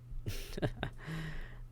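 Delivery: quiet airy chuckle; soft breathy laugh. Acted. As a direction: laugh softly under your breath. Amused at the fangs.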